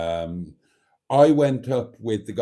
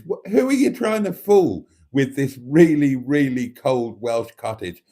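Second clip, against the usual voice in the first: louder, incredulous teasing; mock outrage; 7 dB louder than usual. Real, not acted. Vlogger teases a friend's cold cottage claim, loud and incredulous. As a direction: call out his bluff with a laugh in the voice.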